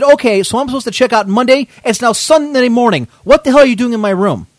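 retold loud, high indignation; livid. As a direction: build your case to an outraged question. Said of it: "I'm, I'm livid".

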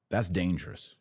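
ordinary talk

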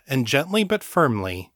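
ordinary delivery, nothing marked

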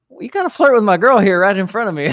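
high, fast mock complaint; mock aggrieved friend. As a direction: whine it as the jealous friend. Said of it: "that friend who's like".

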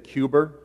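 "Cuber", Cuba ending in an R; added R on Cuba. Retold or imitated. Preacher imitates Kennedy saying "Cuber", with an intrusive final R. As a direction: overcompensate with an R at the end of "Cuber". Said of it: "an overcompensated non-Boston accent".

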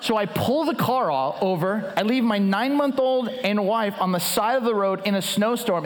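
normal talking voice

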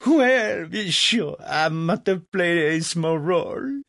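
high-pitched, loud Legras-style pomposity; haughty French impresario. Acted. Legras replies to Franklin's praise, puffed-up French impresario. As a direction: puff up with false modesty in a high voice.

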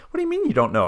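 high, loud exasperated disbelief; incredulous. Retold or imitated. Reacting to her not checking her nose, incredulous. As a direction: pitch it way up and demand an answer.

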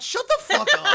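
high, loud mock scream; mock furious outburst. Host snapping at a complaint about class average, mock furious outburst. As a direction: explode in exaggerated outrage.

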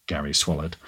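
plain reading voice

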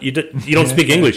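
can't believe the nerve; incredulous. Retold or imitated. Skipper repeats accusation, exasperated.

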